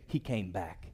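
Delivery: very soft, low aside; feed the line quietly for others to repeat; hushed, understated statement; low prompt. Shown as a demonstration. Preacher prompts congregation to repeat.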